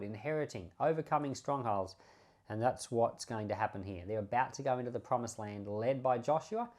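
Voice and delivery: usual speaking voice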